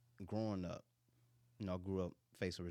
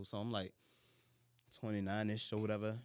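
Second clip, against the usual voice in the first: ordinary talk, nothing marked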